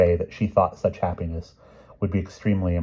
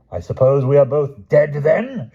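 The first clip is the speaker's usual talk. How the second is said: loud, high, slow shout; King Lot shouting at his wife; 9 semitones higher, 10 dB louder than usual. Acted. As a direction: bellow it sarcastically. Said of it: "shouted King Lot".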